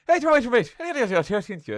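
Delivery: high, loud, rapid-fire mock Irish gabble. Mocking his Irish relatives to friends, incoherent mock Irish gabble. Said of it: "they are incoherent. I have to make them slow down".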